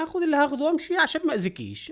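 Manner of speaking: high, loud caricature of a robber; mock thief threat. Commentator voices robber threatening pharmacist, high mock menace. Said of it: "قال لها".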